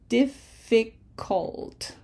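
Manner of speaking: "Difficult" in three slow, separated beats, dif-fi-cult; very slow, sounded-out "Difficult"; broken into syllables. Shown as a demonstration. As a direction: leave a clear gap between each syllable of "Difficult". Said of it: "broken up in syllables like this".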